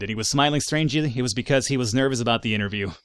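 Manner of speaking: usual speaking voice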